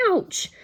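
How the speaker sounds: high, loud sharp "Ouch"; pained yelp. Acted. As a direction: cry out in pain. Boy whacked again.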